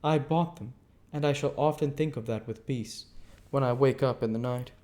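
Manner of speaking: prim lady. Acted. Camilla voiced.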